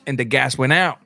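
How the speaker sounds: ordinary talk, nothing marked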